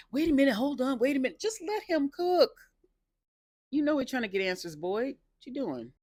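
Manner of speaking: high, loud exasperated pleading; frustrated. Retold or imitated. Viewer telling Boyd to stop interfering, frustrated. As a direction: scold him in comic exasperation, pitch way up. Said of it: "I was getting so frustrated with Boyd".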